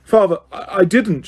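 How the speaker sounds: put-on a boy begging his father; terrified shriek. A narrator's reading. Son in chains to his father, frantic shriek. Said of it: "shrieked the boy".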